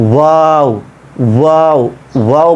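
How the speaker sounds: ওয়াও with a rounded English w, lips nearly meeting; repeated ওয়াও, starting on a full w glide; English W sound. Shown as a demonstration. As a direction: round both lips into each drawn-out ওয়াও. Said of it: "ইংরেজিতে এটা রয়েছে ওয়া sound".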